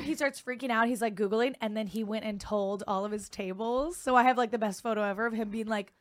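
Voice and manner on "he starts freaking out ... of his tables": ordinary delivery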